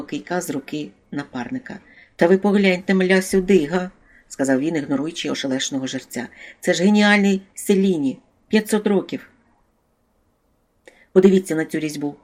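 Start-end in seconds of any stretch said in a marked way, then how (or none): 2.2-3.9 louder, higher, insistent exclamation; excited. A narrator's reading. Thief showing off a silver candlestick, excited. 6.6-12.2 loud, higher-pitched admiration; excited. A narrator's reading. Thief recognising a master's work, thrilled.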